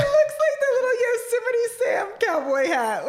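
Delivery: laughing through the words; cracking up mid-sentence; giggling while teasing him. Real, not acted. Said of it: "What the fuck, dude?".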